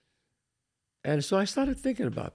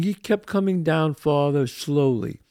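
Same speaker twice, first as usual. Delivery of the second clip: ordinary delivery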